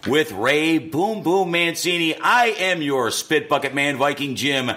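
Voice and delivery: booming slow ringmaster intro; loud, drawn-out boxing announcer call. Acted. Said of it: "these introductions, man, getting better and better".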